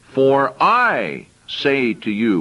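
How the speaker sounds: slow, weighty "For I say to you" with "I" stressed; emphasized I. Shown as a demonstration. Preacher driving home Jesus' words, slow and weighty. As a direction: drag it out and lean hard on "I". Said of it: "now I want to emphasize".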